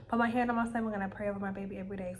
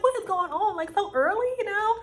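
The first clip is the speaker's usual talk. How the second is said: mock disbelief; 10 semitones higher, 6 dB louder than usual. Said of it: "'cause I'm like".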